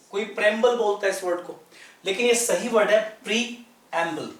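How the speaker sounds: normal talking voice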